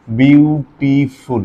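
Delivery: Beautiful drawn out very slowly, syllable by syllable; syllable demo. Shown as a demonstration. Teacher shows students the syllables of beautiful, slow. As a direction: stretch beautiful into its separate syllables. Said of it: "এটা কি এক সিলেবালে হয়েছে".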